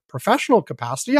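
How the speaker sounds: normal talking voice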